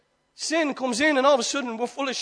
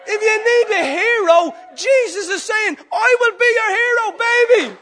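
preaching climax; 11 semitones higher, 10 dB louder than usual. Acted. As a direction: cry it out triumphantly.

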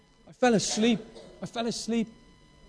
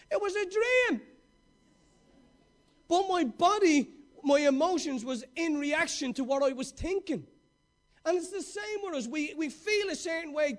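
higher and louder, pounding each phrase; rising sermon shout, slowing to hammer it home; full-force preaching; 7 semitones higher, 9 dB louder than usual. Acted. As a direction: preach it out to the whole room.

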